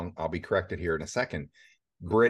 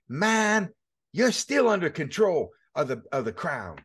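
put-on South African accent; 10 semitones higher than usual. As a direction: mimic his South African accent, pitched way up. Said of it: "He's got that, that South African accent".

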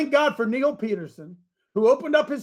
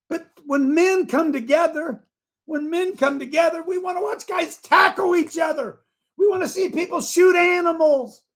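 fired-up preaching; 10 semitones higher than usual. Real, not acted. Pastor firing up his men, shouting. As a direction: preach it hard, caught up in the fire.

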